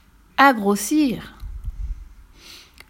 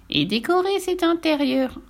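high teasing witch voice; witch's high-pitched taunt; gloating witch; 8 semitones higher than usual. Acted. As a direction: trill the threat with relish. Witch teases the boy.

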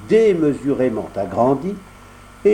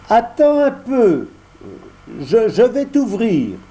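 put-on higher, slower puppet boy voice; voix de pantin; 10 semitones higher, 4 dB louder than usual. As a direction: puppet boy stalling at the door. Pinocchio parle à Lumignon. (voix aiguë de pantin)

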